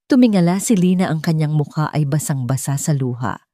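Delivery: usual speaking voice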